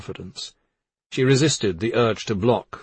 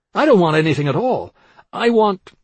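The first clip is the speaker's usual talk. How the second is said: high, loud protest breaking off; agitated refusal; 8 semitones higher, 7 dB louder than usual. A narrator's reading. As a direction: refuse hotly, then stop short. Dan rejects her suggestion, breaks off.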